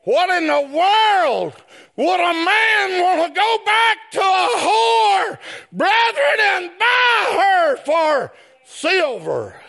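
ringing sermon outcry; preaching at full force. Real, not acted. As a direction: hammer the question home. Preacher shouts question.

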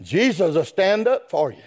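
ordinary talk

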